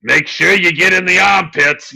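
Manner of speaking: high, loud alien doctor voice; Doctor Phlox voice. Retold or imitated. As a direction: bark orders like a fussy alien doctor. Imitating Doctor Phlox instructing.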